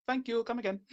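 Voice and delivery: Apu impression. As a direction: lightly imitate a cartoon shopkeeper's Indian accent. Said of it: "Doing the Apu voice".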